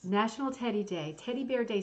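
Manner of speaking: ordinary talk, nothing marked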